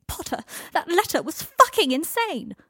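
high, loud yell, voice cracking. Acted. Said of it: "yelled, voice almost cracking".

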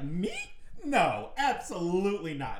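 high-pitched guilty denial; high lying voice. Retold or imitated. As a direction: let the voice jump up as you lie. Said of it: "my voice goes up an octave higher".

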